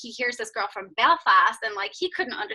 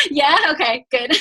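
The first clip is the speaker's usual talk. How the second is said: laughing through the words; real laughter; 9 semitones higher, 7 dB louder than usual. Real, not acted. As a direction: breaks into high laughs mid-reply. Laughing at her own question.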